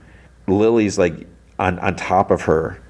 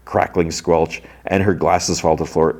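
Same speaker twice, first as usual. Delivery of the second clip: usual speaking voice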